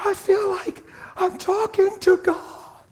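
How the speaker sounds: very high, slow tearful wail; acted overwhelmed crying voice; imitated bawling. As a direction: draw it out high, as if sobbing in awe. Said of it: "she's bawling".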